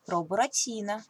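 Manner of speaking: ordinary delivery, nothing marked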